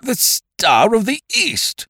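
very high, drawn-out amazement; astonished gasp. A narrator's reading. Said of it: "gasped Lord Yardley".

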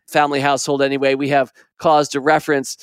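plain reading voice

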